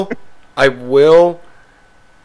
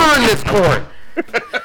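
high, slow, drawn-out call to order; mock judge call; 9 semitones higher, slower than usual. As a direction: stretch it like a hammy judge banging a gavel. Judge calling the court to order.

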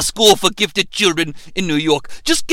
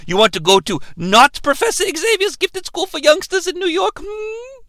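coaxing question; 9 semitones higher than usual. Retold or imitated. Doctor coaxing Michael.

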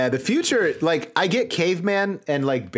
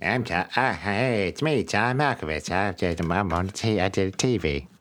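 a hint of Malkovich, low and halting; lightly voiced Malkovich, pitched down; John Malkovich; 6 semitones lower than usual. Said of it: "do a John Malkovich impression".

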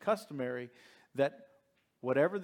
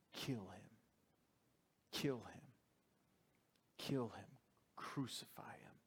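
softer dramatic near-whisper, not a shout; hushed mob cry; 4 semitones lower, 13 dB quieter than usual. A narrator's reading. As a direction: breathe the crowd's demand intensely. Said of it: "And they shouted back".

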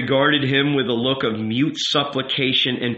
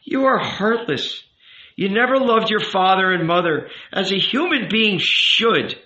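higher-pitched, emphatic rabbi voice; reproachful rabbi; 7 semitones higher than usual. A narrator's reading. Rabbi rebuking the youth for loving no one, reproachful. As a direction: scold as a wounded elder.

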